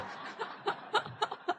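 a short, airy laugh at her own joke; amused airy chuckle; breathy laugh. Real, not acted. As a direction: let a soft unvoiced laugh slip out.

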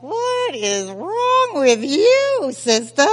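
high, slow, drawn-out question; exasperated self-scolding. Retold or imitated. Waking up next day, exasperated self-reproach. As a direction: stretch each word in mock disbelief. Morning after, scolding herself.

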